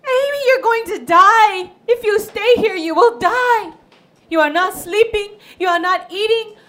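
high, pleading alarm; mother pleading with her sick daughter; worried mother. Acted. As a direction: beg her daughter to leave, frightened.

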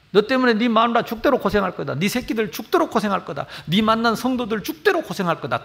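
죽도록 hit hard on each repeat; mock threat. Pastor warning seminary students, stressing 죽도록. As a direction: lean on 죽도록 every time, falling. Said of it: "막 이렇게 협박을 합니다".

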